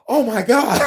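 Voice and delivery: high-pitched retold astonishment; shocked kid. Kid in class hearing teacher curse, shocked. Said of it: "like when you first hear your teacher curse".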